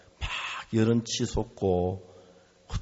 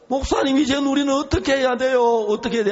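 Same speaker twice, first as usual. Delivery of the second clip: much higher, louder, fast pleading; imitated weeping wife begging for help; retold tearful plea; 11 semitones higher, 6 dB louder, faster than usual. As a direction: cry out helplessly to the pastor. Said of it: "하고 울고 있었어요".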